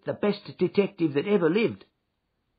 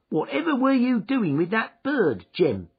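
a higher, louder, sharp sister's query; sister's sharp question; 7 semitones higher, 4 dB louder than usual. A narrator's reading. Sister demanding why Jem grabbed the goose, sharp and high. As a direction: voice the sister as she scolds.

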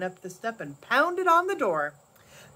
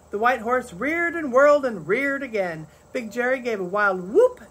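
ordinary delivery

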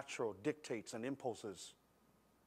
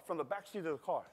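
ordinary talk

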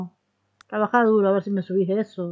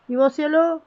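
high doting coo; 5 semitones higher, 5 dB louder than usual. Pretend. Cooing at pet.

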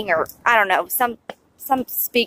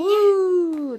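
bright high whoop; cheering woo; 9 semitones higher, 7 dB louder than usual. Real, not acted. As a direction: celebrate his little win. Cheering child's accomplishment.

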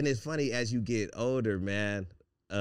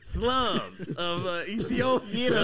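higher, louder wail broken by laughs; laughing mock lament; 5 semitones higher, 4 dB louder, slower than usual. Joking to friends about high school, laughing mock wail. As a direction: cry it out as a joke, cracking up. Hammed-up war story lament.

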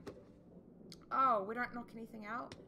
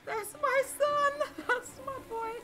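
high, slow, loud anguished wail; imitating Amos; 10 dB louder, slower than usual. Grieving father crying over his son, mock anguished wail. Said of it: "he's like".

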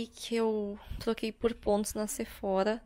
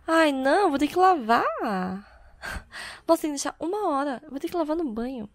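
dismayed exclamation; 5 semitones higher, 6 dB louder, faster than usual. Real, not acted. Dismay at the product instructions.

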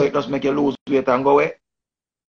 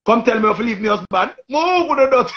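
high, loud mock outburst; hammed-up shrieking rant; imitating Queenie yelling; 10 semitones higher, 5 dB louder than usual. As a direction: squeal it up high like she's losing it. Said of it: "Queen just say".

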